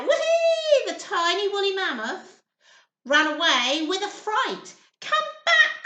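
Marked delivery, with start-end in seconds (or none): 0.0-0.9 high, loud squeal of delight; little girl shrieking with joy at the mammoth. Acted. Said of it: "squealed with delight". 5.0-5.8 high, loud call after the mammoth; little girl calls after the running mammoth. Acted. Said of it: "called Lily Lou".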